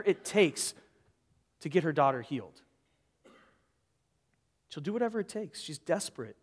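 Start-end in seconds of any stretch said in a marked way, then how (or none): none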